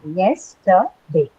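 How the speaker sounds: yesterday spoken slowly, each syllable apart. Shown as a demonstration. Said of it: "what would be those syllables?".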